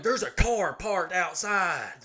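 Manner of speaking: high, slow, urgent mock cry; alarmed wife. Wife bursts into the bathroom, high drawn-out alarmed announcement. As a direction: imitate an alarmed wife raising her voice.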